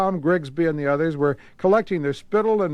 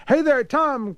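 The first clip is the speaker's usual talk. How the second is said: cry; 10 semitones higher than usual. Acted. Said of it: "cried Grigsby".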